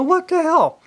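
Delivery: a dad caught off guard by his son; startled outburst. Retold or imitated. Son runs off after prank.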